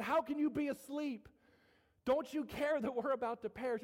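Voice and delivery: urgent appeal, pitched high; crying out. Acted. Frightened fishermen waking Jesus, crying out. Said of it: "And they're crying out".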